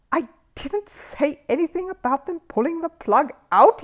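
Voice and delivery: high, strained, indignant host; flustered host. A narrator's reading. Red-faced host repeating himself at the bear. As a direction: sputter with wounded pride.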